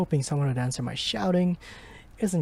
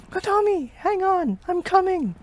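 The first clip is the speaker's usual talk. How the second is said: panicked shout; 6 dB louder than usual. Acted. Searching for her in fire.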